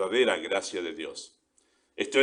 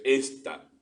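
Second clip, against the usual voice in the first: "esta" set apart, higher, with weight on the E; stress on "esta"; 4 semitones higher than usual. Shown as a demonstration. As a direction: lean on the first vowel of "esta". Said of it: "con un acento ahí en la E".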